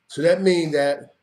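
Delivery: ordinary talk, nothing marked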